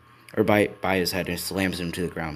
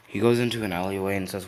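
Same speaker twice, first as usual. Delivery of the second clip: ordinary delivery, nothing marked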